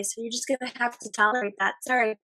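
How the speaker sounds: hoarse, fading voice; losing voice. Real, not acted. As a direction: voice giving out. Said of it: "I'm losing my voice today".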